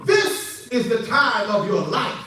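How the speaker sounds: emphatic preacher's proclamation; preach. Real, not acted.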